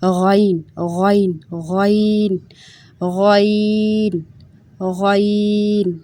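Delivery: غین said thick and gargled from the throat, stretched at the end; heavy throaty غین; heavy gargled letter. Shown as a demonstration. Teacher demonstrating a heavy gargled غین. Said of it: "یہ بھی موٹا پڑھا جانے والا حرف ہے".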